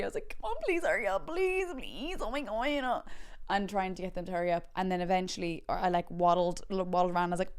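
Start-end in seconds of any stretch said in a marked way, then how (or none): 0.4-3.0 high mock whine; frantic pleading. Kid waving to distant parents, frantic high pleading. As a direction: beg them in a squeaky wail. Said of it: "like, waving frantically".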